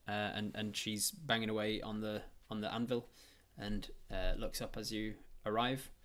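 usual speaking voice

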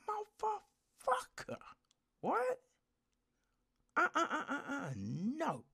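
high, stammering shock at someone's nonsense; incredulous disbelief. Retold or imitated. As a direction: splutter at a ridiculous remark. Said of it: "someone says something and I'm just like".

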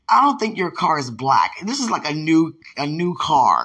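normal talking voice

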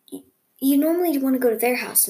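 usual speaking voice

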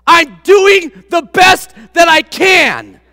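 slow, loud and high, every word pushed; young referee shouting back at the parents, slow and loud; upset shout. Retold or imitated. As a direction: call it out to the parents, stung. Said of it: "and he said this".

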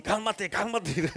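slow, soft, drowsy warning; mock threat from someone half asleep; startled sleeper. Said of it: "y los despierta uno y".

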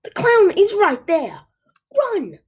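high, loud urgent scream. A narrator's reading. Julia urging frozen Marco to run, screaming. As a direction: scream at him to flee. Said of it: "I screamed to Marco".

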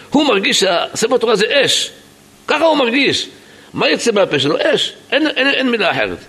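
high, urgent and agitated; impassioned alarm. Retold or imitated. Explaining what the rabbi felt seeing fire, high and agitated. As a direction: argue it in a high, alarmed pitch.

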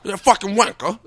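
loud, high-pitched mock British insult; thick British accent. Imitating Tom Hardy's British roles for podcast friends, thick British accent. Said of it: "the thick British accent".

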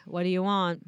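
low, deadened monotone; dead voice. Retold or imitated. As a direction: answer as if dead inside. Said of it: "I go into my dead voice".

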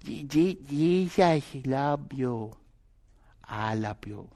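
put-on soft, slow, halting Filipino-accented English; broken Philippine accent. Filipino woman tells children about Jesus in a soft broken Filipino accent. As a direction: imitate a Filipino learner's broken English, gently. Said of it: "in her broken Philippine accent".

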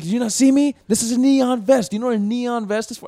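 high, loud mock indignation; imitated talking down. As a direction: scold like a self-important cop demanding to be seen. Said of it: "talking down so bad".

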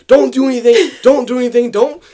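loud, high urgent mimicry of mom; mom panicking. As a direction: shout it as a frantic order. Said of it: "she's freaking out".

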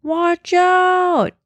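high shouted call from afar; yelled warning. A narrator's reading. Boy warns kids from far away, high shouted call. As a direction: yell it across the playground. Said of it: "yelled a boy from far away".

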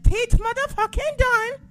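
high, loud mock British shout; UK fan impression. Naming next week's opponent, high mock British yell. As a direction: burst into a hyped British fan squeal. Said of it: "That's how I'm gonna say his name from now on".

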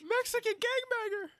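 very high-pitched cry; mock alarmed shriek. Passengers reacting to a screaming pilot, high shriek. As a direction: squeal it in pretend alarm.

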